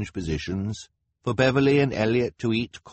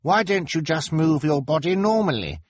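put-on high-pitched child character voice; inquisitive boy; 9 semitones higher, 5 dB louder than usual. A narrator's reading. Klaus questioning the ashamed contortionist, inquisitive. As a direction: reason with her, pitched well up.

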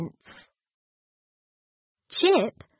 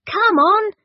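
high, loud and lively; eager call; 11 semitones higher, 5 dB louder than usual. A narrator's reading. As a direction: call out keenly to the others. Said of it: "said Chip".

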